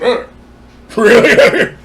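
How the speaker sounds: loud, drawn-out groan of disbelief; mock exasperation. Man imitating township hearing the complaint, mock exasperation. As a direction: imitate a hammed-up groaning reaction.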